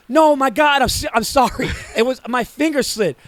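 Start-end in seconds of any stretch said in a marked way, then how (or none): 0.1-1.7 loud, high mock-panicked apology; frantic apology. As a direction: act out a desperate sorry. Retells frantic apology for wrong emoji.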